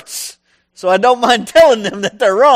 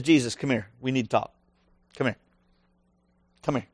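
quiet, lowered voice, pulling someone aside; hushed aside; 9 semitones lower, 8 dB quieter, much faster than usual. Acted. Peter pulls Jesus aside, hushed and urgent. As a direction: draw him close and say it low.

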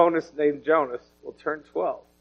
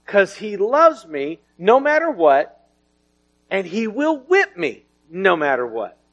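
preaching with fervor; 6 semitones higher than usual. Acted. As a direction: drive the point home like a revival preacher. Preaching at rising force.

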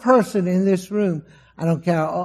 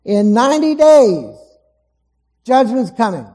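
Jonah calls out the judgment, loud and higher; loud proclamation; 5 semitones higher, 7 dB louder than usual. Retold or imitated. Jonah preaching doom to the Ninevites, loud proclamation. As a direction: proclaim it like a prophet in the streets. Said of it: "preaches and says to the Ninevites".

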